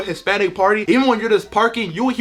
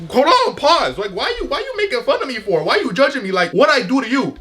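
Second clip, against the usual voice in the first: mock indignant; 5 semitones higher than usual. Protesting relatives' questions.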